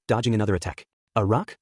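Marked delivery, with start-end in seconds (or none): none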